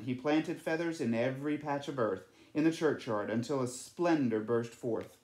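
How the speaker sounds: usual speaking voice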